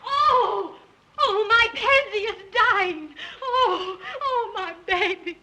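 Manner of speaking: put-on slow sobbing lament over her baby; melodramatic wailing. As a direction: wail it, half laughing half crying. Wife wails over hurt dog.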